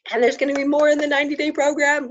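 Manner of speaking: high, fast and thrilled; bubbling over with excitement; excited. Real, not acted. Teasing upcoming program excitedly.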